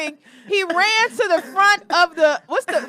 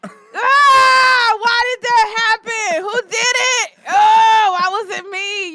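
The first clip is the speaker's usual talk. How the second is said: mock anguish; 6 semitones higher, 9 dB louder, much slower than usual. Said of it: "you just be like".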